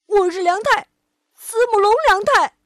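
high, loud declaration; little dinosaur yelling his name; defiant shout. Acted. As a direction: shout it proudly, fighting tears. Child defiantly declares identity.

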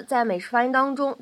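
ordinary talk, nothing marked

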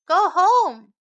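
loud, high-pitched order; chasing a stray away with a loud command; firm go home. Acted. Other cats order him away.

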